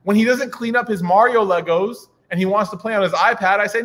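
ordinary talk, nothing marked

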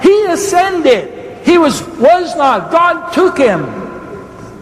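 high, ringing pulpit proclamation; drawn-out, high emphatic declarations; exclamatory preaching. Real, not acted. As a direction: proclaim each name in triumph. Preaches Old Testament ascension examples.

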